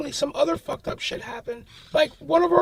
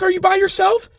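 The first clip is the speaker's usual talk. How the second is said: freaked out mother; 4 semitones higher, 8 dB louder than usual. Retold or imitated. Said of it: "my mom got so freaked out".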